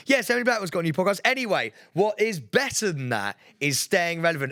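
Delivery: rattling off the rival podcast fast, then slowing for his own; rushed throwaway plug. Retold or imitated. Retelling his cheeky live radio read, hurried and dismissive. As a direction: speed through the name like it doesn't matter. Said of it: "I literally went".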